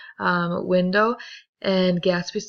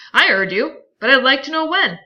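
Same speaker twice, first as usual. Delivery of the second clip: higher and louder demand; pressing Tom; 7 semitones higher, 9 dB louder than usual. Acted. Tom presses Gatsby for a date, loud and rising. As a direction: push him, rising challenge.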